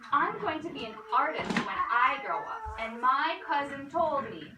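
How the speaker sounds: loud, earnest protest; insistent protest. Acted. Tommy telling the art teacher real artists don't copy. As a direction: stand firm and tell her.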